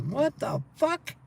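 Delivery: long, drawn-out what the fuck; reliving seeing a goose on the road, drawn out; stunned disbelief. Retold or imitated. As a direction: stretch it out in total shock. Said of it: "I'm like".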